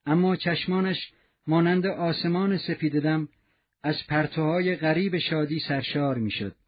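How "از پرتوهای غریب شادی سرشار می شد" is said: ordinary delivery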